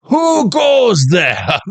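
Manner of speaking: high, drawn-out mock shout; hammed-up high holler; mock sentry challenge. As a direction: call it out slowly like a challenge. Mock challenge echoing the Chinese shout.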